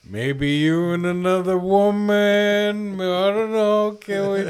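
high, slow pleading guy voice; whiny begging guy. Retold or imitated. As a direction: beg like a pushy boyfriend. Guy begging partner in mock voice.

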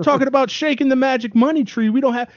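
put-on high Jamaican accent; a playful Jamaican accent. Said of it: "I'm doing a Jamaican accent".

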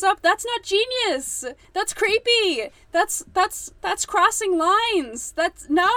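high and loud, appalled; outraged shout. Real, not acted. Scolding a book character, appalled shout. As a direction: yell your disgust at the character.

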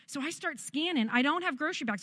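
ordinary delivery

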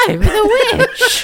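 very high, loud, slow cackling witch. Shown as a demonstration. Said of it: "the witch sounds like this".

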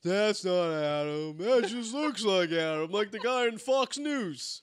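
slurring it out like a tipsy wife; drunk wife. Retold or imitated. Drunk wife at the bar doubting it's Adam, slow. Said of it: "And Steve'd be like".

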